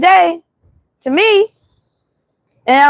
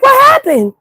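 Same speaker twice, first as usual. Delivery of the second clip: retold question; 6 semitones higher, slower than usual. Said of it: "I'm like".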